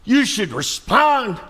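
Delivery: indignant scolding. Acted. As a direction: lecture them like they've lost their minds. Preacher scolding lazy firehouse.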